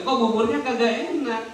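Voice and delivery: put-on high, puzzled complaint from an old man; complaining old man.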